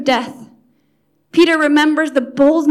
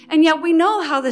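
usual speaking voice

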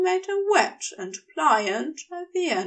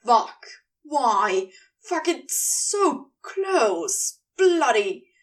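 loud, exasperated swearing burst; frustrated cursing; 3 dB louder, slower than usual. Acted. Crowley to Gabriel, cursing in frustration, loud. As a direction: let the frustration spill out in curses.

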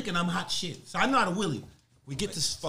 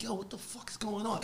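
whisper; 6 semitones higher, 7 dB quieter than usual. Retold or imitated. Uneasy aside in standoff.

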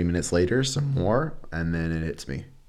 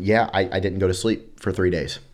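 usual speaking voice

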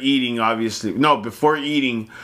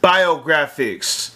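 slow, drawn-out hype call; 3 semitones higher, much slower than usual. Shown as a demonstration. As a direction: stretch the word out for the crowd. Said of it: "Say it with me now".

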